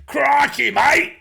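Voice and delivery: high, loud jokey Australian Crikey; mock Australian accent. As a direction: lay the Aussie accent on thick. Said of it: "going around doing".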